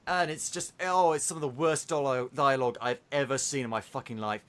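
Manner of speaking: normal talking voice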